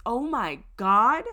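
slow, loud mock horror, rising on the confession; mock scandalized. Host imitating prudish women to her co-host, mock scandalized. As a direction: imitate a prude aghast, drawn out and loud.

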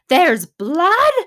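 high, loud hammed-up panic; mock panic. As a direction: play the paramedic freaking out at the scene. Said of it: "they're panicking and freaking out".

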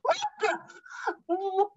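high, drawn-out disbelieving why; slow, high shock; shocked woman on the doorstep, high and drawn out; stunned disbelief. Retold or imitated. Stunned at surprise on live TV.

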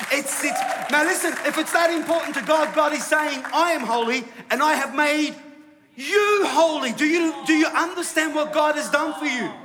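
high, loud, emphatic preaching; impassioned sermon climax; full-force preaching. Real, not acted. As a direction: drive it home to the congregation at full voice.

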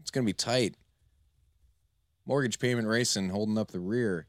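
lively race-calling announcer voice. Acted. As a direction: call the chicken race like live sports. Holds race announcer calls.

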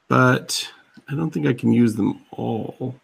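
ordinary talk

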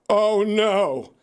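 high-pitched comic dread; mock alarm. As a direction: play a panicked audience member, pitched high. Audience dreading being singled out.

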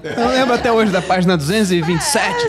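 high laughing parody; laughing mock boast; mocking through laughter. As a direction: tease him while laughing. Mocking a precise page memory.

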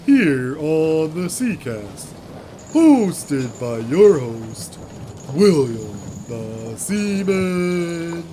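slow drawn-out radio host; announcer voice. Acted. Host announcing show name.

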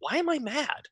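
asking herself, bewildered and quiet; a mock-baffled inner voice; confused self-quote. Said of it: "I'm like".

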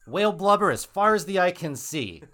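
loud, high, grand vision; hammed-up proclamation of plenty; mock prophet's promise. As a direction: sell paradise to a crowd. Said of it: "paint a vision of heaven to the townspeople".

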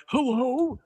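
high, put-on phone greeting. Sisters playing characters on the phone, high put-on hello. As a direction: answer like a fussy stranger. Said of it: "I'll be like".

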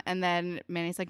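ordinary talk, nothing marked

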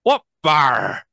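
a light put-on Scottish show-host accent; Scottish accent, only lightly put on. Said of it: "I'm just gonna go all stereotype".

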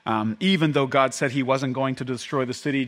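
ordinary delivery

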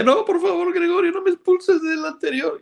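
very high, squeaky begging voice; mock pleading member. Imitating members begging Gregorio not to expel them, in a squeaky high plea.

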